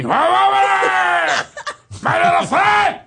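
high, loud gangster shout; Scarface impression. Shown as a demonstration. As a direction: yell it like Scarface. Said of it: "I had to be Scarface".

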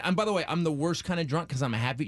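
ordinary talk

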